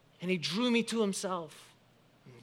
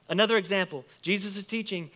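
ordinary talk, nothing marked

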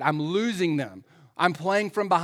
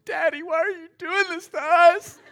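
high, loud mock sobbing wail; crying son; 13 semitones higher, 7 dB louder than usual. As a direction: wail like an upset child breaking down. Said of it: "one of our sons breaks down crying".

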